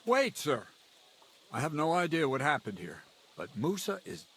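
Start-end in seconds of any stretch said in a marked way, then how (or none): 0.0-0.6 urgent call. Acted. Stopping the knight from leaving.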